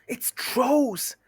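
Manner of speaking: high, urgent little hobbit voice; high-pitched alarm from hiding; Bilbo's warning cry. Acted. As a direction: call out a warning, pitched well up. Bilbo warns from behind tree.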